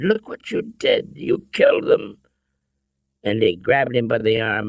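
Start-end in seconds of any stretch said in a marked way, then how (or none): 0.0-2.2 horrified. A narrator's reading. Father sees the dead Nazis, horrified. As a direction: gasp it out in horror. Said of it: "He was shocked and horrified".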